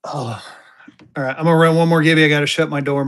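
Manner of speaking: ordinary delivery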